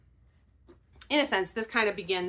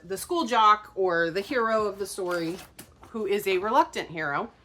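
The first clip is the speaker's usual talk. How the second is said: ordinary talk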